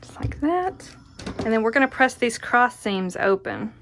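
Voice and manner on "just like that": breathy high whisper. Real, not acted. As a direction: whisper it to yourself. Soft whispered aside while sewing.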